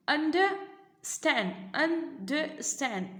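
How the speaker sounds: अंडरस्टैंड slowly broken as UN... DER... STAND; split into syllables. Shown as a demonstration. Teacher dividing अंडरस्टैंड into parts for pupils, broken up. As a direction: leave gaps inside अंडरस्टैंड. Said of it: "ये तीन भाग में ये भी आपका विभाजित है।".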